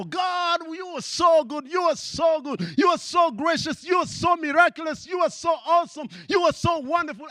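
high, loud shouted praise; congregation praising God. Retold or imitated. African congregation praising God, high ecstatic shouts. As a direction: cry it out to God. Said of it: "keep going and go".

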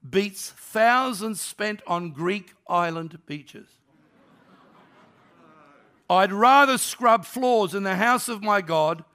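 slow, weighty Bible reading; scripture reading. Acted. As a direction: read it out slowly like holy scripture. Reads scripture aloud.

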